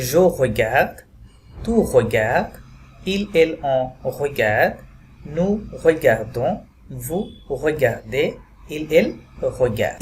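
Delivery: "regarde" said slowly with a French r; slow French repetition. Shown as a demonstration. Teacher repeating the conjugation for students to follow. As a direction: repeat each form slowly for learners. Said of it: "Once again".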